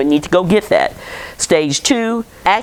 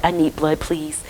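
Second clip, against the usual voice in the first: lowered voice, softening to a hush; really polite; 6 dB quieter than usual. Retold or imitated. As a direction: drop your voice into a hushed, courteous request. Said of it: "I start being really polite".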